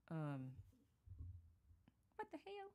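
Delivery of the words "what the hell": put-on US Southern accent. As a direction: imitate Hale's US Southern twang on "what the hell?". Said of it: "I love it with her U S Southern accent".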